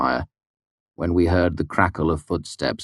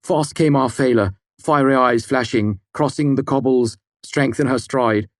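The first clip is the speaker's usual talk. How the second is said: louder, higher, grand epic recital; sonorous recitation; 9 semitones higher, 5 dB louder than usual. Pretend. Sim reciting heroic verse about Fela, sonorous epic recital. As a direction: declaim it like an old saga. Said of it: "spoke in a sonorous voice".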